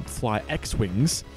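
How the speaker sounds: usual speaking voice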